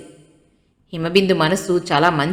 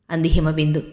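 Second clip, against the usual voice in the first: ordinary delivery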